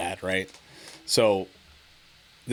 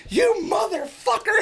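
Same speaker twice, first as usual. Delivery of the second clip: very high, slow mock outrage; mom cussing him out; 3 dB louder, slower than usual. Mom reacts to his mean joke, high squealing outrage. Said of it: "and she goes".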